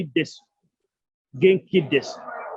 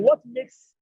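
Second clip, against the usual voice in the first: high squeak. Shown as a demonstration. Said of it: "question to class".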